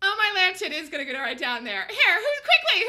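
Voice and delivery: high laughing near tears; laugh-cry in disbelief. Real, not acted. Trader laughing at the chart to his chat.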